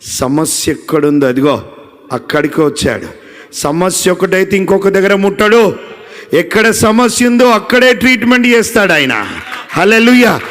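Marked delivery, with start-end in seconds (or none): 3.5-10.4 ఎత్తైన, బిగ్గరైన ఉద్వేగపు ప్రసంగం; హల్లెలూయాలతో పైకి లేస్తూ బిగ్గరగా బోధించండి; preaching at full force. Acted. Preaching climax. (ఉచ్చస్థాయిలో, బిగ్గరగా ఉత్సాహపు ప్రసంగం)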